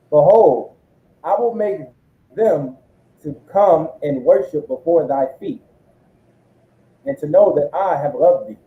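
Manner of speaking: slow, measured reading of scripture. Acted. Reading the verse aloud for the preacher, slow and measured. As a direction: read the verse out with weight.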